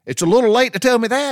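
high, loud, indignant shout; exasperated yell. Retold or imitated. Telling wife it's too late, indignant yell. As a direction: throw it back at her, pitched high. Arguing with wife over car.

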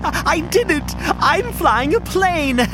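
high gleeful squeals; thrilled laughing. Acted. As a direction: laugh with giddy joy at flying. Joyful first flight.

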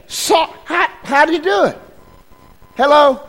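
high, eager calls to the crowd; playful prodding. Real, not acted. Preacher coaxing the congregation to answer, high eager calls.

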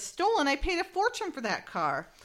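shot up high on stolen, then aghast; indignant surprise. Retold or imitated. Reading Tina's shocked reply about her car, pitched high. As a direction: react in outraged disbelief. Tina hears car is towed.

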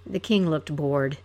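ordinary delivery

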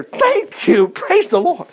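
very high, drawn-out thanks; exclaimed praise. Acted. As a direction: act out jubilant praise at the top of the voice. Acts out praise after repair.

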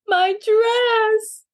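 high distressed wail. A narrator's reading. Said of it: "wailed Maisie".

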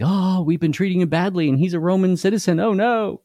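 high, fast mock panic of the guards; panicked guards. Roman guards panicking over mistreating a citizen, mock high squeal. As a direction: squeal it like guards who just realised they're in trouble. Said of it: "They're like".

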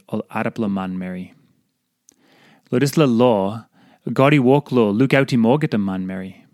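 Tok Pisin devotional reading in Papua New Guinea accent. Real, not acted.

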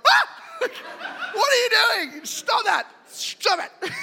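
high frantic yelling at Dad on the water; mock panic. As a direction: shriek at Dad to stop, half laughing. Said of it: "I'd be like".

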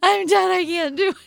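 high, loud half-laughing sobbing cry. Retold or imitated. Woman in labor crying that she can't do it, high sobbing wail.

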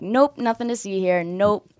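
lightly voiced divine refusal; God. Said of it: "God was like".

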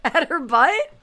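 high, loud laughing accusation. Real, not acted. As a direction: tease her through laughter. Teasing accusation while laughing.

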